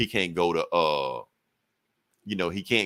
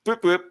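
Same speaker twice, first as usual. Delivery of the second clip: high playful thwip sound; web sound effect; 10 semitones higher, 4 dB louder than usual. Retold or imitated. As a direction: chirp the web noise.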